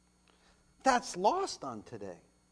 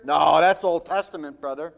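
lower, louder dismissive voice; mock churchgoer; 4 semitones lower, 6 dB louder than usual. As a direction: scoff like a smug church member. Mocking churchgoers' objection.

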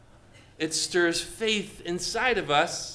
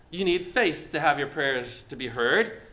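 normal talking voice

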